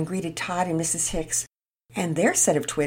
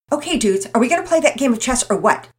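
put-on fast, loud, brisk boy voice; quick change of subject; 8 semitones higher, 9 dB louder, faster than usual. Todd steering friends back to chess, quick and loud. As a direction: hurry past it. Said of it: "Todd quickly changed subjects".

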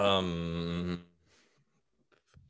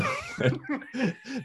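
high burst of laughter; 4 dB quieter than usual. Real, not acted. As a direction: break into giggles. Cracks up reading post.

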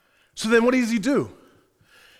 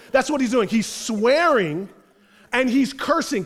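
ordinary delivery

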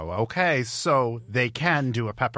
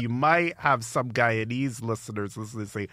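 ordinary talk, nothing marked

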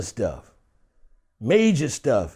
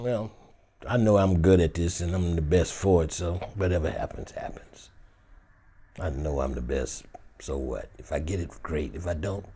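very low, hushed self-assurance; cool private confidence, kept soft; inner self-talk; 13 semitones lower, 7 dB quieter than usual. Acted. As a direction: murmur calmly to yourself. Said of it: "That's what you thinking inside".

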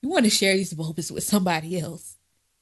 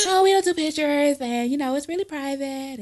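lightly voiced staffer, higher, softening toward the end; imitated museum staffer; 5 semitones higher than usual. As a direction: mimic a staffer politely refusing. Imitates museum staffer refusing pictures.

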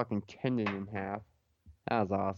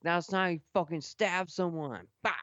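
mock mad; 6 semitones higher than usual. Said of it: "I was mad".

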